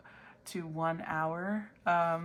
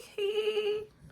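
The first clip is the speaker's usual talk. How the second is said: wizard cackle; 3 dB louder than usual. Acted. Wizard laughs at own joke.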